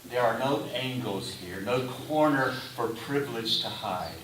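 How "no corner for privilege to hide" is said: ordinary talk, nothing marked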